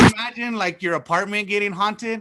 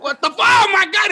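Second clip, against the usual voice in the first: high, loud burst of fright; mock startled yell; 12 semitones higher, 7 dB louder than usual. Startled sleeper finding the dog staring, mock frightened yell. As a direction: cry out as if jolted awake, hammed up.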